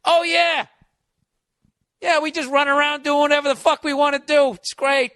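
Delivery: loud mock-boastful yeah, dripping sarcasm; sarcastic. Comic mocking the email with sarcasm. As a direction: brag it up with heavy sarcasm.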